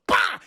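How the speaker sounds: loud, high "bah" burst; mock vampire roar. Dracula recoils from the mirror, loud mock cry. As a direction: bark out a comic roar. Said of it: "He goes".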